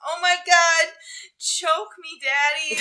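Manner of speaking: high, loud breathy moaning; mock sex moan. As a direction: ham up a porn-star moan. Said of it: "I'm not, like, uh".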